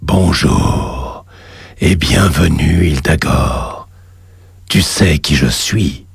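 deep, slow, echoing demon god; cavernous voice. Acted. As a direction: boom it up from the lava below. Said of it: "une voix caverneuse".

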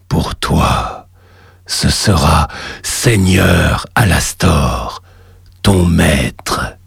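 deep, slow cavernous voice; a god rumbling from the magma; underworld god. Acted. The lava god voice.

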